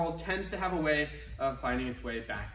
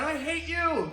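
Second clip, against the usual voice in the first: mock angry shout; 9 semitones higher, slower than usual. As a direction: snap it out at the echo. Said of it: "And shout".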